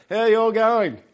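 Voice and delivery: high, loud imitated cabin announcer; announcer's greeting. Announcer greeting Australians on the plane, high loud call. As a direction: boom a cheery Aussie greeting over the plane. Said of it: "hear the announcer come over and say".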